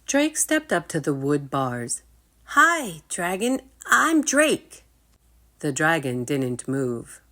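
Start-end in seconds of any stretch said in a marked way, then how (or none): 2.5-4.8 high, loud eager hello; eager boy greeting. Acted. Drake eagerly greets his new dragon, high and loud.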